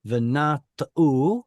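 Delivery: Venata'u slowly, syllable by syllable, landing on final U; stress on last syllable. Shown as a demonstration. Teacher shows another example, Venata'u stressed on the final syllable. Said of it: "supposed to have the stress on the last syllable".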